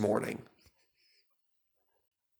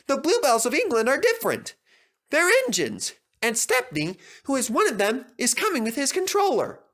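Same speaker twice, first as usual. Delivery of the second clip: triumphant; 13 semitones higher than usual. A narrator's reading. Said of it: "said Percy triumphantly".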